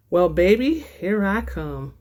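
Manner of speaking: ordinary talk, nothing marked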